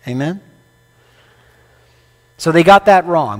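usual speaking voice

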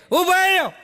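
high, loud call to stop someone. Retold or imitated. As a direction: drunk man calls the narrator to stop, loud and high. Drunk calls out to stop him.

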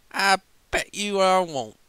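high, loud cheeky boast; cocky Applejack. Acted. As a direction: tease her playfully. Applejack's cocky drunken reply.

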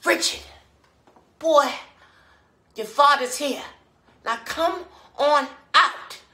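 high, loud summons with drawn-out ending; mother calling her boy. Acted. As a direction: call him out through the closed door. Mother calls boy out of room.

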